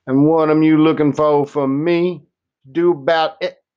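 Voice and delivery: a mildly voiced old woman teasing a visitor. A narrator's reading. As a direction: speak up high and sharp as a tiny tough old woman. Old woman questions sternly.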